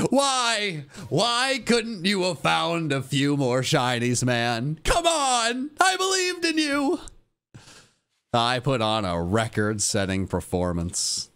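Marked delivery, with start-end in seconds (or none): 0.1-7.0 high, wailing lament; hammed-up anguished plea, pitched high; mock betrayal. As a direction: wail melodramatically at a partner who failed you. Mock betrayal scolding game partner.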